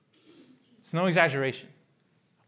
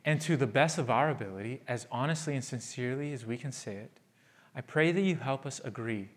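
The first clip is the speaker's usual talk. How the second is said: quiet prayer; 7 semitones lower, 9 dB quieter than usual. Acted. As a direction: pray gently for the congregation. Closing prayer.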